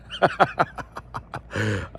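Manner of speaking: forced-sounding chuckle; forced laugh. Real, not acted. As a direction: laugh as if making yourself do it. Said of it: "most genuine, not forced laugh".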